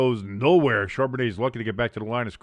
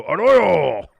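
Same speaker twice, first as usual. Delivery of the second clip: plain shouted name; 6 semitones higher, 10 dB louder than usual. Shown as a demonstration. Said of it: "I didn't go".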